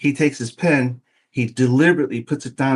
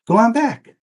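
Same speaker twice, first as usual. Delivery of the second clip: higher, casual front-desk lady; receptionist voice; 10 semitones higher, 4 dB louder than usual. Retold or imitated. Receptionist sending the salesman back to Dan, higher and breezy. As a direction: toss it off like an amused receptionist.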